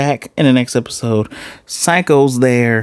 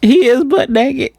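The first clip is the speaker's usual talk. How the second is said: excited high-pitched rant. Real, not acted.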